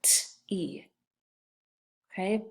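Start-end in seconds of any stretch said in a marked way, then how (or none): 0.0-0.8 "T-E" as a bare t, then ee; softer, lower "T-E" in two separate sounds; sounded out. Shown as a demonstration. Teacher tapping the second syllable, "T-E" as isolated sounds. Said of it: "Second syllable".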